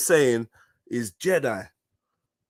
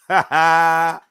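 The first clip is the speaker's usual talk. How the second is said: short loud laugh; 7 dB louder than usual. Real, not acted. Laughs at own conclusion.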